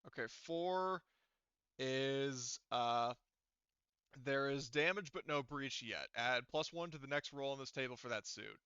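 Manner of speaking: usual speaking voice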